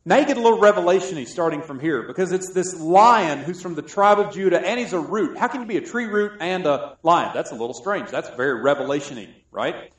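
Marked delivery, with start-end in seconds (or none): none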